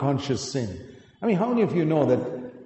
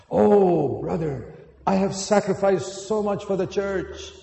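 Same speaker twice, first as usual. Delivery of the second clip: whiny self-pitying complaint, higher and louder; hammed-up grumbling about ungrateful people; mock complaining; 6 semitones higher, 4 dB louder than usual. Mimicking a church worker who complains to a brother, whiny.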